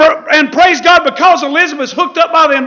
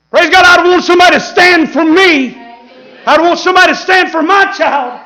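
loud, higher cry of conviction; preaching shout; 4 semitones higher, 5 dB louder than usual. Real, not acted. Preacher bursts out mid story.

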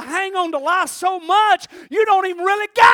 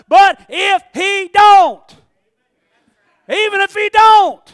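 but if He don't, slow and loud, higher; slow shouted climax; 6 semitones higher, 10 dB louder, slower than usual. Real, not acted. Preacher to the congregation, slow loud shout. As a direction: drag it out and shout it. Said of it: "preaching climax".

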